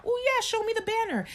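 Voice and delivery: high, fake-eager voice; mock high reply. Replying to a man's sneaky picture, mock eager and high. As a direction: play along, sweet and high. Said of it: "mock reply".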